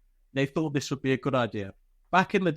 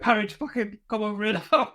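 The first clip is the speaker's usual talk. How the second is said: higher-pitched mock order, close to laughing; imitated instructor holding back laughter; 6 semitones higher than usual. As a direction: mimic an instructor struggling to get the words out. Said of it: "couldn't get his words out 'cause he was laughing".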